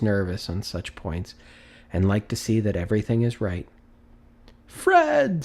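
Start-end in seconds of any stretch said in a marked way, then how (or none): 4.7-5.4 high ringing call of a name. Acted. Scrooge calls out to Fred, high and ringing. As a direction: call his nephew's name across the room.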